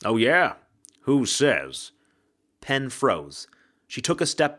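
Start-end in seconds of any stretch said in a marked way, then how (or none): 0.0-1.9 a lightly voiced wry ghost, louder; a hint of a cocky man's voice from the shadows. A narrator's reading. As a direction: unseen ghost taunting a frightened bookseller. Ghost speaks from the dark.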